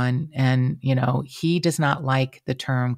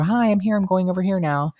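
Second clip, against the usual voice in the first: lifted, higher singsong hello; bright greeting to the computer; 5 semitones higher than usual. Retold or imitated. Host waving hello to a Zoom guest, higher cheery call. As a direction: chirp a cheery hello to nobody. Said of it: "like, wave to the computer".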